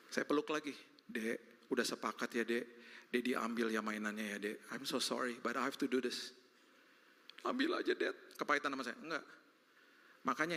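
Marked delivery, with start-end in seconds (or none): 1.0-6.3 lower, hushed and kind. Retold or imitated. Father gently taking toy. 7.4-8.2 put-on high, resentful kid; sulky child. Son giving up his toy, high and sulky.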